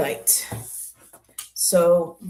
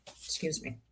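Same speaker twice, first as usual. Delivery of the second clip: ordinary talk, nothing marked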